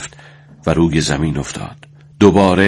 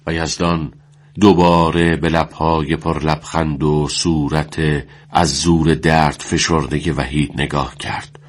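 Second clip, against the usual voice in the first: ordinary delivery, nothing marked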